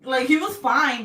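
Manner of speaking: loud, high laughing speech; laughing hard. Real, not acted. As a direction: crack up while telling it.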